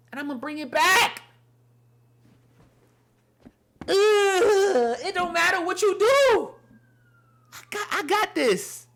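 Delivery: emotional crying sermon; tearful. Real, not acted. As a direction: fight back tears while speaking for God. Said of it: "I'm getting so emotional right now".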